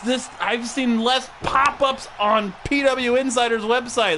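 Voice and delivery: ordinary delivery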